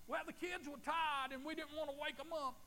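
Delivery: high, loud whiny excuse-maker; mock excuse voice. Preacher mimics a parent's excuse, whiny and loud. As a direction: whine out the lame excuse.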